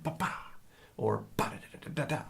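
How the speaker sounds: soft, percussive "ba-da" syllables; imitated drum accents. Shown as a demonstration. Teacher showing two ways into the exercise, imitated drum accents. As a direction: sound out drum strokes under the voice. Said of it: "starting specifically on the dominant accent or you're leading into it".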